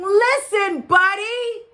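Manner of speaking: loud, high parents' yell. A narrator's reading. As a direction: yell to get through to a child who won't listen. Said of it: "His parents tried yelling".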